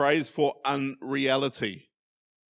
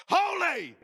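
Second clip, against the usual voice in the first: Holy! shouted out, much higher, sharp fall; emphatic shout. Shown as a demonstration. Pastor shows the congregation what the threefold holy means, sudden shout. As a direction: burst out with a single Holy!. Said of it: "Sorry if you got a fright".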